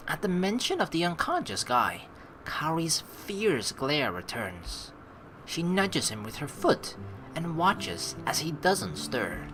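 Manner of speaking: slow, soft narrator read; story narration. A narrator's reading. Narrating Kaori nudging the unconscious guy, slow hushed story narration. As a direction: set the tense scene slowly and quietly. Reads game narration aloud.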